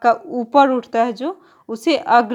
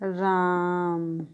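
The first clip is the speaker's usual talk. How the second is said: राम held very long and slow, a lengthened vowel, pluta style; drawn-out call; 4 semitones lower, 7 dB quieter, much slower than usual. Shown as a demonstration. Teacher demonstrates calling someone in a play, राम drawn out slowly. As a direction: stretch राम out like calling across a distance. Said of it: "किसी को पुकारा जाता है तो राम".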